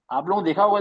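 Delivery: usual speaking voice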